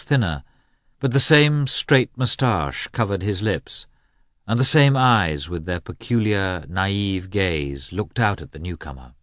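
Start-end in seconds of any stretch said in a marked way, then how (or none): none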